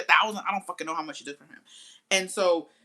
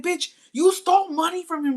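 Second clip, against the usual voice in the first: high, loud exasperated snap; loud high indignant snap; exasperated retort; 12 semitones higher, 8 dB louder than usual. Retold or imitated. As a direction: throw it back at her, loud and high. Snapping at friend on phone.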